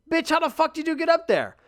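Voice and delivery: high, loud mock scolding; incredulous outburst. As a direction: yell at your cat in comic disbelief. Retold scolding of the cat.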